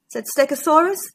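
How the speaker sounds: ordinary delivery, nothing marked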